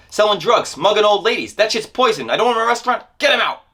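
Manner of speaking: high, agitated rant; raving. Retold or imitated. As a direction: keep the raving going, spitting mad. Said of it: "he raved".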